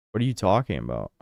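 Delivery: usual speaking voice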